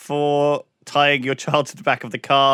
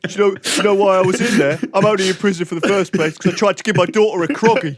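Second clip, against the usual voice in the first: higher, louder hammed-up convict, laughing through it; jailed dad character; 6 semitones higher, 4 dB louder than usual. Prisoner telling fellow inmates why he's inside, hammed-up loud laughing. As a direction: play the wronged convict for laughs.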